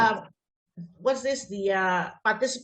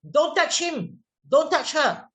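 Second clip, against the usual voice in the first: higher-pitched telling-off; sharp scolding; 5 semitones higher, 3 dB louder than usual. Acted. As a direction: scold a child for touching. Acts teacher scolding children.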